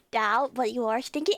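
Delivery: usual speaking voice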